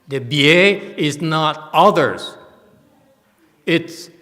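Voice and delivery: preacher rising to a loud peak; emphatic build. Real, not acted. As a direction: hammer home that it's only for them. Stressing the teaching is special.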